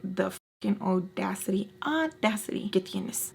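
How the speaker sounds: seething whispered indignation; hushed outrage. Retold or imitated. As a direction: say it under your breath, appalled. Retold outrage under the breath.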